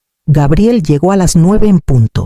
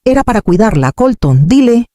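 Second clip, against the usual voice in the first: cutting; 7 semitones higher than usual. Acted. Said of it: "Brielle intervino cortante".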